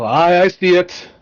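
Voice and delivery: a hint of a Scottish dwarf, alert; gruff dwarf. GM as the dwarf to his party, a light gruff brogue. Dwarf NPC spots yeti.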